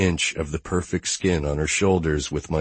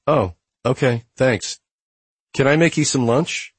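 female character voice; 8 semitones higher than usual. A narrator's reading. Gia voiced by male narrator.

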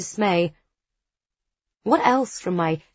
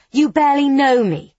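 furious, much louder and higher; 8 semitones higher, 8 dB louder than usual. A narrator's reading. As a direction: lash out in fury. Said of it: "I say furiously".

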